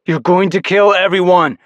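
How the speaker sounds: high, loud shout. Acted. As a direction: yell at him to stop. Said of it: "I shouted".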